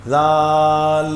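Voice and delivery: ذال chanted with the l wrongly held; wrong dhal. Shown as a demonstration. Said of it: "یوں بھی نہیں".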